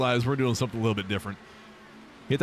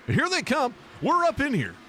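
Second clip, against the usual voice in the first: excited; 12 semitones higher than usual. Real, not acted. Cars arrive, streamer gets hyped.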